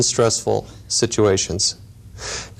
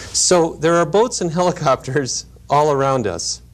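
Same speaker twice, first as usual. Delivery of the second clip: ordinary delivery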